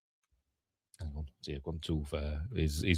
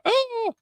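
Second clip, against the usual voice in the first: tiny high-pitched mock squeal; high startled "oh". Girl seeing brother asleep in chair, high mock startled squeal. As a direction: squeak out a put-on startled cry.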